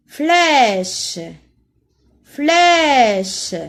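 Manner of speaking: FLÈCHE said loud with a long open è. Shown as a demonstration. Teacher lets pupils hear the accent grave, long open è in Flèche. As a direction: draw out the è in Flèche, loud and clear. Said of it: "J'entends E avec accent grave".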